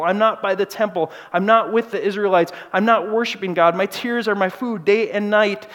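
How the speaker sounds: loud, aching lament of a thirsty soul; anguished lament. Retold or imitated. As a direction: cry it out like the psalmist in exile. Voicing psalmist's anguished lament.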